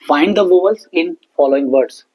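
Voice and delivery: very slow worksheet instruction; slow dictation. Shown as a demonstration. Teacher reading the worksheet instruction slowly to pupils. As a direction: read the task aloud slowly. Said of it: "teacher reads worksheet instruction".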